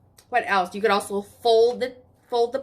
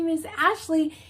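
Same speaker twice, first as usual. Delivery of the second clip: ordinary talk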